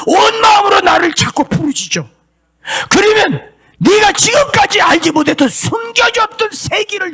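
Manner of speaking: God's voice through the preacher, high, shouting, tearful; preaching at full force. Acted. Preacher voicing God to the congregation, high crying shout.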